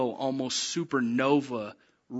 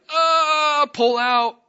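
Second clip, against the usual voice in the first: high, loud wail of alarm; mock panicked cry; 13 semitones higher, 10 dB louder, much slower than usual. Part of her fighting the wave, mock panicked cry. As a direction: cry out to escape, hammed-up.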